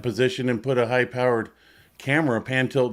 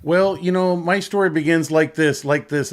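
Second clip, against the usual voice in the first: higher, louder mock storyteller voice; drawing it out like Nora telling a story; mimicking Nora; 5 semitones higher, 3 dB louder than usual. Imitating Nora to Jackson, higher and louder. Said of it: "that's where she says".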